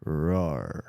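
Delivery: low mock rawr; a low put-on rawr, not fierce; deep growl. Shown as a demonstration. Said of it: "say it with a deeper voice".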